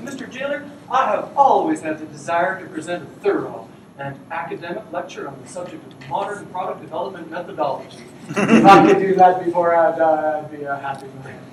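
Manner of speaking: a hint of a keen nerd asking his last wish; eager prisoner voice. Condemned programmer asks the jailer for his wish, lightly voiced eager plea.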